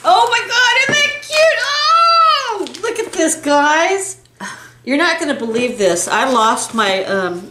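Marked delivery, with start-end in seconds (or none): 0.0-4.2 very high delighted squeal; excited squeal. Real, not acted. Showing viewers a cute gift, excited squeal. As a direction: squeal high, then ease down.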